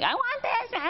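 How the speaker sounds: high mock whine of a child; whining kid. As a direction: pitch it way up like a whiny kid. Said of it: "if your kid's whining".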